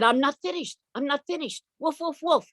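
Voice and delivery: high yappy puppy voice; eager little dog. Acted. Little dog tells the family he is not finished, high and yappy. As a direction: insist proudly like a tiny dog.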